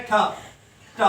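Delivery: ठ said alone, a crisp retroflex puff, twice; letter sound demo. Shown as a demonstration. Teacher to students showing the English T sound, crisp ठ. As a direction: sound out ठ clearly for the class. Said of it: "और इसका sound क्या है?".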